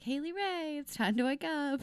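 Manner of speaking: high, slow wake-up call to herself; sing-song it like a phone alarm; lightly voiced morning alarm; alarm message voice. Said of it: "and I'd be like".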